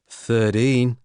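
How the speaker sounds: put-on broad Australian accent, falling. A narrator's reading. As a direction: say it the Aussie way, drawn out. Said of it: "said in her broad Australian accent".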